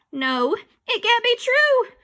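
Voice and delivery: high, slow, loud wail; horrified denial. Acted. Boy refusing the future, wailing. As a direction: deny it in panic. Arlo in disbelief.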